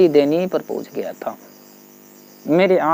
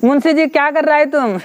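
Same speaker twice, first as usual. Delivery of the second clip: calling out; 8 semitones higher than usual. Retold or imitated. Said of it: "आवाज दे करके बोला".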